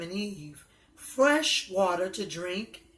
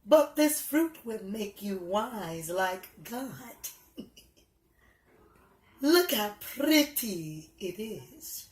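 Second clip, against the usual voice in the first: sly serpent. Acted. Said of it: "Then the serpent replied".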